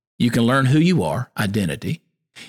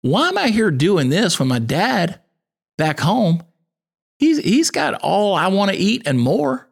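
raised, earnest voice of the lost son deciding to go home; prodigal son coming to himself; 7 semitones higher than usual. Retold or imitated. The starving son in the pig slop resolves to go home, earnest and raised. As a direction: voice the prodigal son, pitched up and pleading. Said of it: "he's like".

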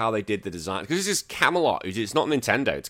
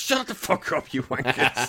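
mock angry; 4 semitones higher than usual. Cursing at heckling friends.

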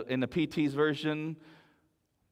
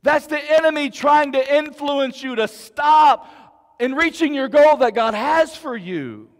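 shouting preacher; 10 semitones higher, 9 dB louder than usual. Real, not acted. Preaching climax to congregation.

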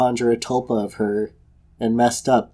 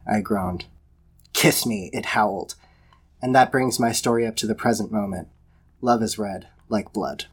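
measured storybook reading voice; story narration; 2 semitones lower than usual. A narrator's reading. Podcaster reading a dark love story aloud, in narrator voice. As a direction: read it like a fireside tale.

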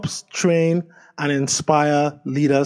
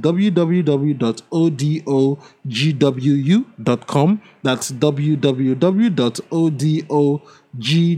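each letter spaced out slowly; slow spelling out; 6 dB louder, slower than usual. Acted. Host spelling his website for listeners, slow and deliberate.

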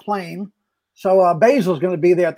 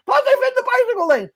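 Kevin doing me. Retold or imitated. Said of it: "impression of Kevin doing me".

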